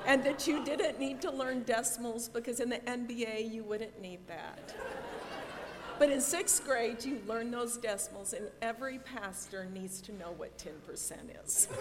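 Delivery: laughing loud teasing. Real, not acted. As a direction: tease him through laughter. Teasing son, laughs heard.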